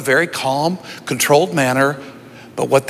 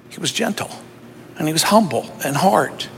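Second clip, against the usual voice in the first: softly spoken; 3 semitones lower, 5 dB quieter than usual. Real, not acted. Describing Jesus softly.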